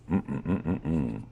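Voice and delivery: soft pretend mmm of delight, before tasting; mock yummy hum. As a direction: hum as if it's already delicious. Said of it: "he says without trying it".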